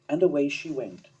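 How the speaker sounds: ordinary delivery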